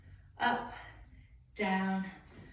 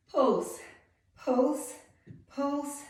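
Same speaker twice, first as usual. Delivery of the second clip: higher and louder through the burn; straining count; 6 semitones higher, 8 dB louder, much slower than usual. Real, not acted. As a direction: push through the last reps. Straining through final pulses.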